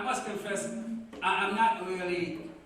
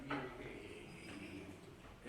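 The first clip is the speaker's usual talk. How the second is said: skeptical mutter; 7 semitones lower, 16 dB quieter than usual. Retold or imitated. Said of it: "He was skeptical, like".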